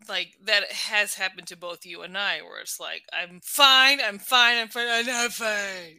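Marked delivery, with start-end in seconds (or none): 3.1-6.0 mock denial, loud at first and easing off; insisting, then cracking. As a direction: insist you're fine, then drop to a slow admission.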